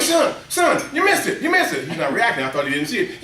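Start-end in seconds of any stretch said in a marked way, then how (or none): 0.0-1.9 high, urgent call to the son; excited calling out. Retold or imitated. Dad calling his son to the TV, excited. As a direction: call him over, pitched up.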